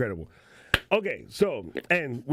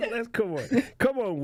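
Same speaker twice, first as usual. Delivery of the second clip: laughing; 11 semitones higher than usual. Real, not acted. Laughing cheering Will.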